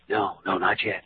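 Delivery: hushed, low, very soft reply; conspiratorial whispering. Acted. Husband secretly answering his friend, whispering. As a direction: murmur it so nobody overhears. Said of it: "What are you two whispering about?".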